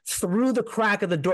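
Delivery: ordinary talk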